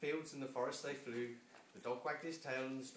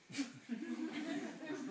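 dragon laugh. Acted. Dragon laughs before feast.

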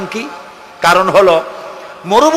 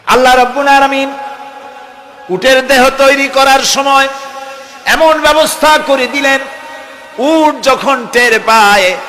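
high, sustained sermon cadence; preaching; 6 semitones higher than usual. Real, not acted. Preacher telling listeners of God's design for the camel, high preaching cadence. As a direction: lift the voice and stretch the phrase ends. Preacher raises his chant-like delivery.

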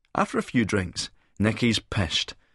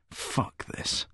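low-volume, voiceless mutter; hushed, fed-up aside; muttered disgust; 8 dB quieter than usual. A narrator's reading. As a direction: mutter it under your breath. Narrator's disgusted muttered thought.

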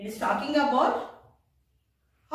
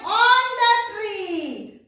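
"On the tree" slow and higher, stretched; sing-song answer; 5 semitones higher, much slower than usual. Shown as a demonstration. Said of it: "So what you should write there?".

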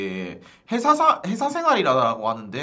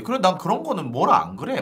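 normal talking voice

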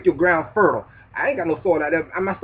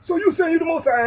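much higher, incredulous objector voice; high-pitched scoffing questioner; mock doubter; 13 semitones higher, 3 dB louder than usual. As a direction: play the skeptic who can't believe it. Voicing an imagined doubter.

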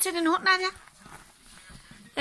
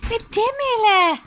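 higher, louder, slow coo; pet talk; 7 semitones higher, 7 dB louder than usual. Real, not acted. Owner coos at Milla, higher and louder. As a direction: dote on the dog. Talking to the dog.

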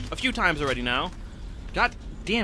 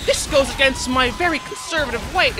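hammed-up shocked pundit voice; mock outrage; 7 semitones higher, 5 dB louder than usual. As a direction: play a scandalised conservative. Mock outrage at game monsters.

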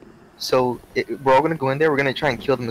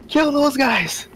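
urgent shout; 12 semitones higher, much slower than usual. Real, not acted. Urging friends in fight.

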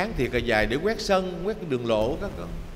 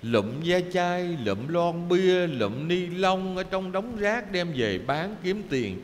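slow listing; 3 semitones higher, slower than usual. Acted. Slow listing of father's labor.

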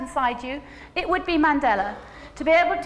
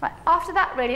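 normal talking voice

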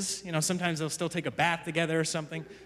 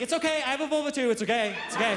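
put-on higher, quick childish reassurance; imitating reassuring little girl; 5 semitones higher, faster than usual. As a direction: play a toddler calming a stranger. Daughter reassuring a stranger.

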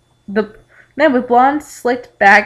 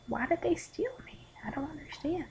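high, very soft whimper; faint, puzzled squeak; small bewildered voice; 8 semitones higher, 17 dB quieter than usual. Acted. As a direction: ask it tiny and high. Kidnapped character's bewildered question, acted.

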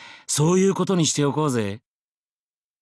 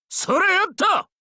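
battle cry. Acted. Combat cry.

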